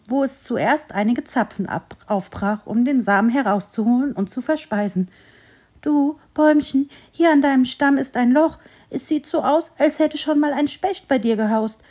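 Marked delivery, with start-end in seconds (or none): 5.8-11.8 higher, louder squirrel thinking aloud; musing squirrel. A narrator's reading. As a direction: weigh the idea in a small squirrel voice. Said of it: "überlegte das Eichhörnchen". (überlegendes Eichhörnchen, höher und lauter)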